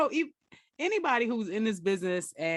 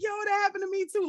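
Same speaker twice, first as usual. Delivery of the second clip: excited friend; 11 semitones higher, faster than usual. Retold or imitated. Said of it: "He was like".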